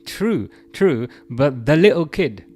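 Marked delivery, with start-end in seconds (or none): none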